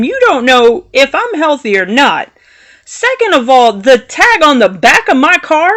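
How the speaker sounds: high-pitched indignation in a retold argument; indignant retort. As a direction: set a stranger straight, point by point. Retold parking quarrel, firing back.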